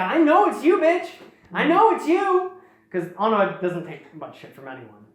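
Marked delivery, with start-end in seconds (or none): 0.0-2.5 high, louder mock accusation; calling someone out. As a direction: call out the hidden foe, pitched high. Acted confrontation with hidden Kraka.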